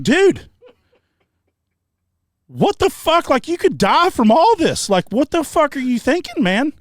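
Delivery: high, loud incredulous yelling; exasperated yell. Retold or imitated. Son yelling at stubborn friend refusing the hospital. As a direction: yell at him for refusing help.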